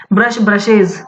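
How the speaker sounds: ब्रशेज ends in iz; iz plural ending. Shown as a demonstration. Teacher repeats rule example, clear iz ending. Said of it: "उनका pronunciation एज होगा".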